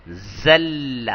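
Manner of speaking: زل with a clear buzzing z; plain z sound. Shown as a demonstration. Teacher showing students the plain z sound. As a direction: say زل as "zal" with an ordinary z. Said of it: "زا کے ساتھ".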